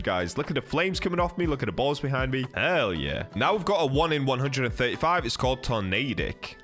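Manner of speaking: normal talking voice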